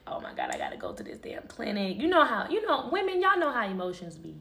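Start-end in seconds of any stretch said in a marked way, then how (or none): 0.1-2.0 low, soft, retold despair; near tears. Retelling dread of the clinic, near tears. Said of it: "I'm damn near crying".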